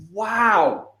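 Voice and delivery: high, stunned, slightly choked wow; floored by what you just heard; a breathless, high wow; amazed wow. Retold or imitated. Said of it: "I was like".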